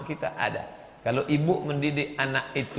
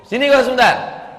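much higher, much louder calling out; mountain-folk shout; 9 semitones higher, 10 dB louder than usual. Retold or imitated. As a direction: yell across the hills. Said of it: "Beda dengan orang gunung".

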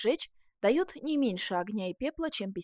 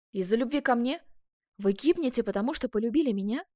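loud, raised disbelief; astonished questioning; 3 semitones higher, 6 dB louder than usual. A narrator's reading. Ascanio hearing the duchess's confession, astonished.

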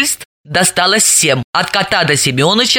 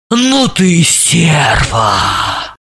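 very slow, drawn-out breath of amazement; exhaled; much slower than usual. A narrator's reading. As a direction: breathe the insult out, stretching it. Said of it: "выдохнула Елизавета". (на выдохе, протяжно)

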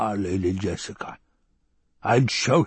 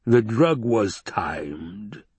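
slow menacing whisper; rumbled whisper; much slower than usual. A narrator's reading. The Baron to his captive, slow whisper. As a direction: breathe it out, unhurried. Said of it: "he rumbled".

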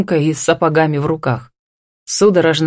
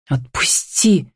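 hissed; slower than usual. A narrator's reading. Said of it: "прошипела она".

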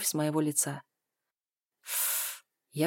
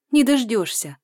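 snorting; 5 semitones higher, 7 dB louder than usual. A narrator's reading. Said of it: "фыркает она".

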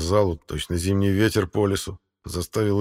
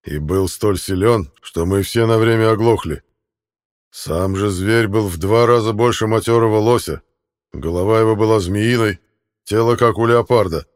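booming warrior king; 2 semitones higher, 7 dB louder than usual. A narrator's reading. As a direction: boom it out, rage barely contained. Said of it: "ответил король". (громогласный король, сдерживающий ярость)

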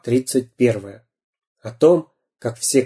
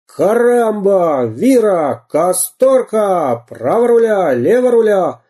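громкие пиратские выкрики; 8 semitones higher, 4 dB louder, slower than usual. Acted.

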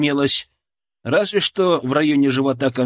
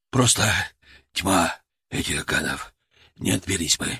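hoarse wheeze; 8 semitones lower, 3 dB quieter than usual. A narrator's reading. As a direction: rasp it out, barely conscious. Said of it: "прохрипел Тор".

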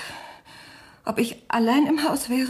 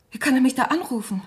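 usual speaking voice

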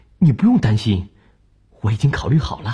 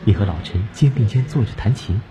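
ordinary talk, nothing marked

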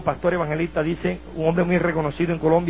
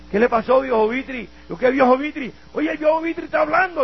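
lightly voiced jeering addicts, pitched up; mocking street addicts; 6 semitones higher than usual. Addicts jeering at the madman who suddenly talks, lightly mocking. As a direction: tease him like a rowdy junkie.

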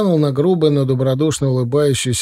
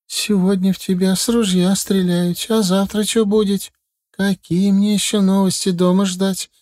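higher, fretful mother's voice; worried mother; 8 semitones higher than usual. A narrator's reading. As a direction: keep nagging out of worry. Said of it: "не унималась мама". (встревоженная мать, выше обычного)

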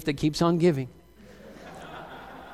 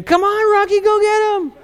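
cheering shout; 14 semitones higher, 4 dB louder than usual. Acted. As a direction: holler it like cheering on a fighter. Acted cheering on of Rocky.

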